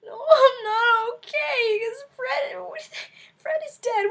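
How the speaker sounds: high, loud wailing sob; mock sobbing. Answering the little girl, mock wailing. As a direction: wail it like a bawling kid. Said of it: "I sobbed like a small child".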